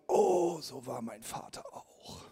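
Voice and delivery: hushed, breathless whisper of sudden insight; stunned whisper, almost tearful; whispered realization. Retold or imitated. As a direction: whisper it as the truth dawns. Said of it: "Dir fällt es wie Schuppen von den Augen".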